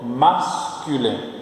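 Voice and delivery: "Masculin" broken into slow, separate syllables; slow syllable demonstration. Shown as a demonstration. Teacher models French for repeating students, slowly syllable by syllable. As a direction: stretch "Masculin" out for learners to copy. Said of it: "você ficar repetindo quando eu tô falando".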